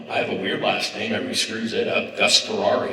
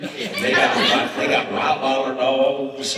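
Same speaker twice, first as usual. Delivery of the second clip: higher, hammed-up Southern lady; bragging in a put-on Southern accent; bad Southern accent; 5 semitones higher, 4 dB louder than usual. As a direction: play a loud Southern lady one-upping her friend. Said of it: "Bad accent".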